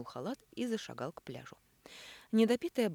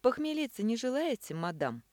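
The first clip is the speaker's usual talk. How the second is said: playful wink; 8 semitones higher, 6 dB louder than usual. A narrator's reading. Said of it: "подмигнул он Элине".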